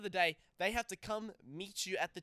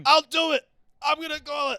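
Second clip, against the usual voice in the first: put-on much higher, loud squeaky kid daring; defiant kid dare; 12 semitones higher, 14 dB louder than usual. Cohost voices Riley daring him to call Auntie Helen, cocky. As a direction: taunt like a cocky little brat.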